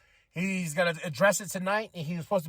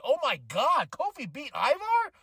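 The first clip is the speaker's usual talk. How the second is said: high, rising disbelief; retold shock, pitched up on the name; stunned; 8 semitones higher than usual. Fan reliving an upset in the ring, stunned. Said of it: "I grabbed my head".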